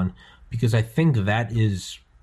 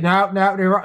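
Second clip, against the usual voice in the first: high, loud Australian protest; Aussie Cossack; 11 semitones higher, 6 dB louder than usual. Retold or imitated. YouTuber arguing with a cop, mimicked loud and insistent. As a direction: cut the officer off insistently. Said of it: "he's the Aussie Cossack".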